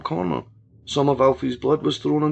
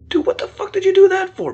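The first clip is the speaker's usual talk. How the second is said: high, loud shocked yell; shocked yelling bro; 6 dB louder than usual. Acted. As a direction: yell it at him, high and appalled. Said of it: "Buddy was calling".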